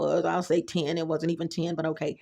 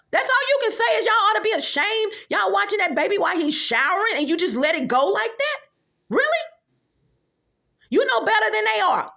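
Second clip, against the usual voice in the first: high, loud indignant rant; outraged scolding; 11 semitones higher, 4 dB louder than usual. Real, not acted. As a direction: scold them in disbelief.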